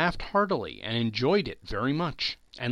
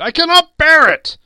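very high, very loud anguished shout; desperate cry; 17 dB louder than usual. A narrator's reading. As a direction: scream it as if in agony. Scrooge cries out to ghost.

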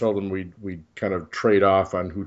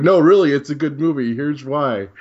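mock insisting; 5 semitones higher, 6 dB louder than usual. Mimicking pushy article writers.

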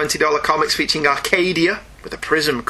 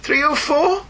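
higher, rising incredulous questions; mock puzzled guessing; 8 semitones higher, slower than usual. Real, not acted. Host mocks the odd hologram issue number, high questions. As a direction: toss out each guess in disbelief.

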